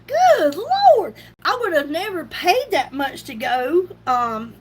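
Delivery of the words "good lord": shocked exclaim. Retold or imitated. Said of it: "I was like".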